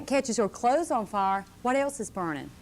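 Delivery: ordinary delivery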